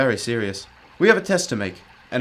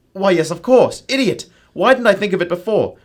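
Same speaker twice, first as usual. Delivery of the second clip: excited realization; 7 semitones higher, 9 dB louder than usual. Acted. Holmes's sudden realization, self-scolding.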